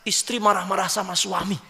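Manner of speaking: usual speaking voice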